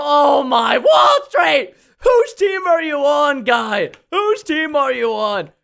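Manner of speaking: high, loud shout at a teammate; outraged yell. Real, not acted. Gamer yells at teammate Wall Street for switching sides. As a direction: call him out in a loud shout. Teammate seems to attack them.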